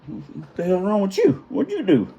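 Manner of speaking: high-pitched mocking onlooker; smart ass. Officer heckles the man sprawled by his chair, mocking. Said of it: "this smart ass is over there".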